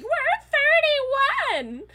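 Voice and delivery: a loud, high-pitched shriek of "thirty-one"; excited shout. Retold or imitated. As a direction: yell it out proud and thrilled. Friends proudly shouting their age.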